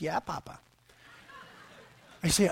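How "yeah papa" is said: soft, lightly voiced little boy; quiet reply, imitating a small child; young son's answer. Son answering his father's sinner question, quiet child imitation. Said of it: "He goes".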